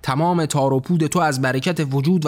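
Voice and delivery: ordinary delivery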